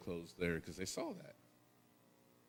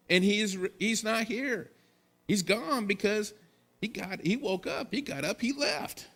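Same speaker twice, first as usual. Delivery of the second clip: high, loud preaching climbing as the angel speaks; full-force preaching; 9 semitones higher, 6 dB louder than usual. Acted. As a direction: preach the angel's words with rising fire.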